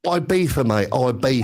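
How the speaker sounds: Ibiza said the London way, EYE-beet-sa; cockney-style Ibiza, mate. Shown as a demonstration. Londoner showing how they say Ibiza, a light London accent. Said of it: "That's the way we say it in London".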